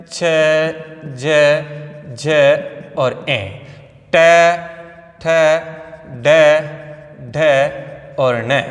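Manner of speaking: each letter said alone with a pause after it; slow letter recitation. Shown as a demonstration. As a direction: spell out the rows slowly for children to copy. (बच्चों के लिए धीमे-धीमे अक्षर गिनाना)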